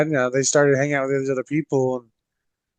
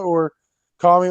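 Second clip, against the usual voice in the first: usual speaking voice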